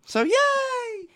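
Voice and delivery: high, drawn-out cheer; squealed little hurray. Real, not acted. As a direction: celebrate with a long high yay. Cheering that busy time is over.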